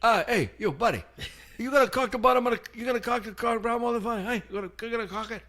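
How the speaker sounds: high mock Italian painter, rushing by the end; imitating the painter. Painter ordering the helper to caulk, high and rushed. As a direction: boss the helper around in a mock accent. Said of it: "He's gonna go".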